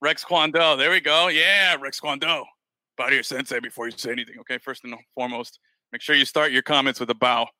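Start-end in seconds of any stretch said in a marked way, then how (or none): none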